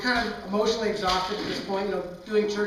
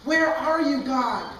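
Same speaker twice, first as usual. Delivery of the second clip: higher, louder anguished call; desperate cry to God; 5 semitones higher, 5 dB louder, much slower than usual. Retold or imitated. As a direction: call out as if begging for an answer. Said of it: "I must have said sixteen times".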